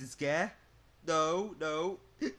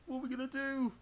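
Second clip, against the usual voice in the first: worried; 9 semitones higher, 3 dB louder than usual. Acted. A friend panics too.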